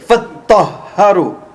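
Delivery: فَطَّهَّرُوا quick with the doubled ṭ and h pressed; emphatic Arabic. Shown as a demonstration. Teacher lets the class hear the emphasis, stressed. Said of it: "you can hear how much emphasis is in that word".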